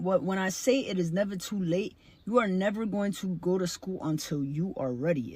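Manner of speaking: normal talking voice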